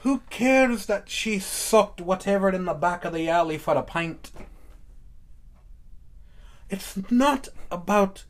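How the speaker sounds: put-on Irish brogue; mock Irish accent. Host joking about the Irish ones, mock Irish accent. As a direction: hold forth as a stage Irishman. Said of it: "doing some stuff with the Irish accent there".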